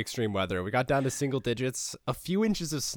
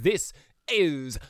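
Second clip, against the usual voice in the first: show title announcement; 6 semitones higher, slower than usual. Acted. Host announces the show name.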